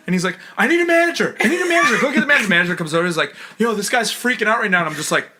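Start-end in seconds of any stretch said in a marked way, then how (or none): 0.6-2.5 high, loud mock demand; full-on actor mode. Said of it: "full-on actor mode". 3.6-4.8 high, loud mock urgency; full-on actor mode. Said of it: "full-on actor mode".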